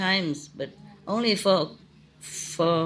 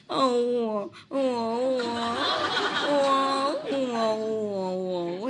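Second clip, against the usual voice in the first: mock dog moan, higher pitched, stretched oh sounds; imitating the dog's talking; 6 semitones higher, much slower than usual. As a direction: copy a dog's long whining talk. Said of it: "He talked very long".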